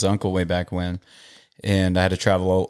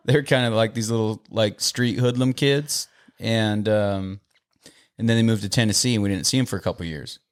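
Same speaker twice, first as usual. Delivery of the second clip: usual speaking voice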